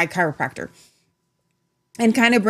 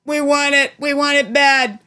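Trump impression; 5 semitones higher, 3 dB louder, slower than usual. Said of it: "That's Trump".

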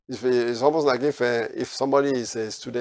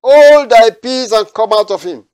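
loud, high, slow commanding rebuke; Jesus rebuking the demon in a loud, stern voice; 9 semitones higher, 13 dB louder, slower than usual. Acted. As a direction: command the spirit to be silent. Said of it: "Jesus rebuked him".

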